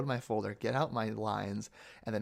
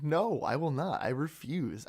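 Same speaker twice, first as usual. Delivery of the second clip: playfully firm refusal, pitched higher at first; emphatic mock refusal; 5 semitones higher than usual. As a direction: act out digging your heels in. Retelling his refusal to audition.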